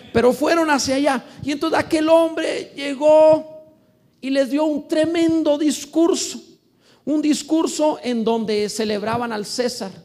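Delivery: loud, high, slow sermon build-up; preaching at full force. Acted. Preacher thundering the story of Herod to the church. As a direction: preach it out, booming to the congregation.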